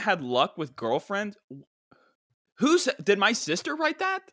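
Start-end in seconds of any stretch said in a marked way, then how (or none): 2.6-4.2 incredulous. Real, not acted. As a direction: burst out in high disbelief. Incredulous outburst.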